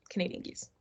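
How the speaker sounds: whispered aside. Real, not acted. Repeats geese warning, dropped to whisper.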